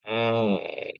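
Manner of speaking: ordinary talk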